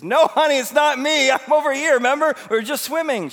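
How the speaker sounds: high, full-volume shout across a pool; calling from afar. Retold or imitated. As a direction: imitate a grandpa hollering over to his granddaughter. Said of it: "who's swimming twenty feet away".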